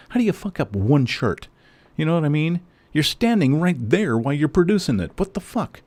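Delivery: shouting his outrage at the shirt maker; furious rant. Real, not acted. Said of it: "I'm so fucking pissed".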